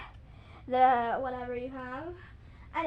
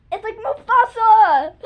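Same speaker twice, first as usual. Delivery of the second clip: excited shout; 6 semitones higher, 14 dB louder, slower than usual. Real, not acted. Excited comparison.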